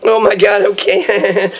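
imitated flattered giggle around the words; mock giggly reaction; giggling woman. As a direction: laugh through it like a smitten woman. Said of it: "and she's all like".

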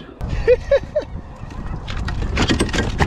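high, drawn-out chuckle; chuckling. Real, not acted. Angler pleased with a little grass fish, high chuckling. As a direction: let out a long chuckle.

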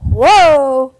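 loud startled whoa; startled shout as the bell swings; surprised whoa. Real, not acted. Swinging a kettlebell.

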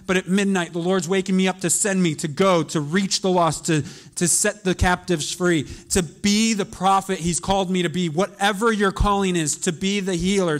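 loud, driving sermon climax; fiery preaching. Acted. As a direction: preach with urgency to the congregation. Preaching at full force.

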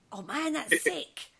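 drawn-out mock-impressed reaction; modern-day slang speaker. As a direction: imitate someone today gushing in slang. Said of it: "It would probably be like".

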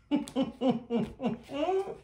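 high bubbling laughter; amused, rippling high laugh; real giggling fit. Real, not acted. Laughs at Craig again.

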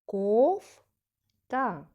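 "Кофта" split, with a loud rising drawn-out first syllable; stress on first syllable. Shown as a demonstration. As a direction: say "Кофта" as KOOF... ta. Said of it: "ми чули голосніше".

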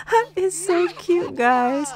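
delighted high squeal; squealing cute. Real, not acted. Squealing over toddler.

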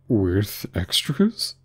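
slow, tentative question; a little anxious. A narrator's reading. Mock Turtle asking about Alice's school, slightly anxious. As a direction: draw it out with slight worry. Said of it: "asked the Mock Turtle a little anxiously".

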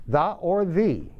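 "The" first with "thuh", then "the" as "thee"; two ways of saying the. Shown as a demonstration. Teacher showing students two ways to say the. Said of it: "las pronunciaciones para T H E tienen dos aceptables".

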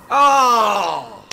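loud drawn-out "oh"; long loud cry of dismay; frustrated yell. Real, not acted. Frustrated scream at game failure.

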